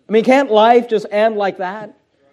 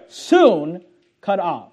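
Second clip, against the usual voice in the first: "soon cut off" very slow, "soon" weighted and held; stress on soon; 3 semitones lower, much slower than usual. Shown as a demonstration. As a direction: draw out "soon" heavily, then cut off. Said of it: "notice this word".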